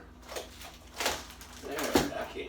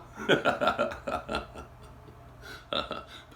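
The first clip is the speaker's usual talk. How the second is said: breathy laugh; 2 semitones higher than usual. Real, not acted. Laughing at own French.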